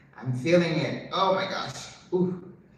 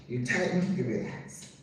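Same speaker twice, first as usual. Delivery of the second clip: "You tighten" soft and rising, "you relax" fading low; soft repeat; 4 semitones lower, 5 dB quieter than usual. Shown as a demonstration. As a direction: quieter echo, "relax" almost breathed.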